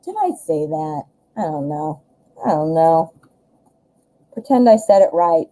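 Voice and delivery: ordinary delivery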